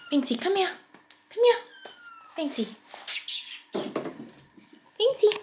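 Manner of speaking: high, bright-pitched cat call; calling a pet. Real, not acted. Owner calling Binksy to come and show a trick, calling.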